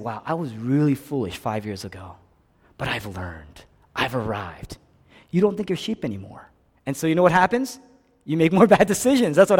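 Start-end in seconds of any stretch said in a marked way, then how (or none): 0.1-4.8 smug quiet musing. Retold or imitated. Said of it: "I look back and I say".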